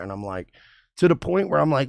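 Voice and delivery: ordinary talk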